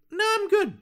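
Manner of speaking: very high, singsong no thanks; mock breezy refusal. Actor declining samurai audition, mock-polite high refusal. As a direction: turn it down in a tiny high voice.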